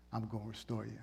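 soft reassuring Jesus; tender Jesus. Retold or imitated. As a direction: reassure him gently. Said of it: "eyes of love".

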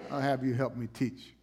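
ordinary talk, nothing marked

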